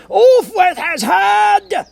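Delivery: shrieked high death sentence; shout. A narrator's reading. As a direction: scream the sentence at the top of your voice.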